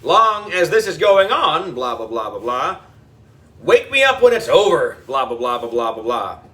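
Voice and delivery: low, bored mumble of blahs; droning mock blah. A narrator's reading. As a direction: tune him out with a bored drone.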